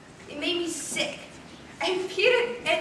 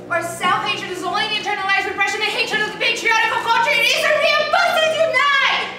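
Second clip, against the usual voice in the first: rallying cry; 5 semitones higher, 6 dB louder than usual. Acted. Performer spouting the slogan about self-hatred, rising to a shouted rallying cry. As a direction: chant it like a slogan, louder and higher to the end.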